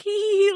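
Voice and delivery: high-pitched "hee hee hee"; mock girly giggle. Doing the girl thing in the corner, mock giggle. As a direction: imitate girls tittering together.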